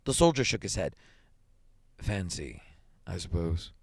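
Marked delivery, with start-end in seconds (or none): 2.0-3.7 low, soft musing with a pause; slow, hesitant. A narrator's reading. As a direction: say it slowly, feeling for the answer. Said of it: "he said slowly".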